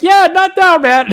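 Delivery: high, loud mock dismissal; imitating Dan. Dan shutting Matt down on the show, mock high voice. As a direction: brush Matt off with a squeaky high voice. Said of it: "he was like".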